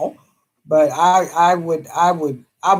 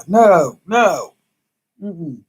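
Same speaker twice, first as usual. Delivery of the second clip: ordinary delivery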